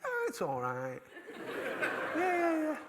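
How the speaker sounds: high-pitched mock caller; squeaky put-on woman's voice; imitated phone chatter. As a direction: chat away on the phone, oblivious to the room. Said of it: "She's saying like this".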